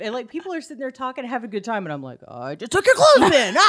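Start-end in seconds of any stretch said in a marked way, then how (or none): 2.3-3.7 loud, high competitive crowing; over-the-top gleeful taunt; gloating mock yell. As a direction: shout it in hammed-up triumph. Acts out gloating at shower game.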